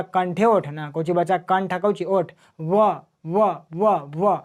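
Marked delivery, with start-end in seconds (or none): 2.6-4.4 व said alone four times, rounded at the lips; lip sound demo. Shown as a demonstration. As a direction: isolate each व to show the lips at work. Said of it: "कोची ओठ आ रहा".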